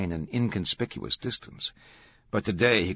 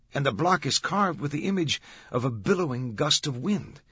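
higher-pitched, earnest guard's voice; guard reporting; 7 semitones higher, 3 dB louder than usual. Acted. Guard describes the carved marble block to Olivetti, reporting. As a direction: report the details to a superior.

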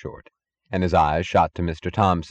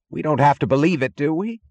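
high, pleading cry; desperate cry; 8 semitones higher, 3 dB louder than usual. A narrator's reading. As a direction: cry out, almost threatening, begging agreement. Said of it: "cried James Taggart".

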